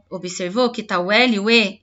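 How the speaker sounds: ordinary talk, nothing marked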